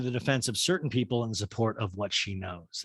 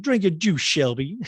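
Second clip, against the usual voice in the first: higher and louder, a mother nagging in a put-on voice; hammed-up film mom line, higher and louder; Sally Field quote; 5 semitones higher, 5 dB louder, slower than usual. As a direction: mom urging her daughter to drink up, loud and high. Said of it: "Sally Field's like".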